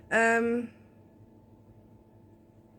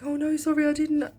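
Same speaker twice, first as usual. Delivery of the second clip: apologetic soothing; 6 semitones higher than usual. Retold or imitated. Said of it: "I was like".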